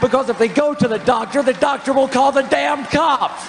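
high impassioned shout. Real, not acted. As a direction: rant it at the crowd. Candidate shouting his speech.

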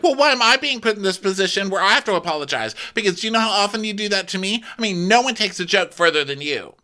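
high, indignant housewife voice; mock aggrieved, high-pitched; imitating Kyle. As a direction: protest in a high put-on voice. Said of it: "And Kyle's like".

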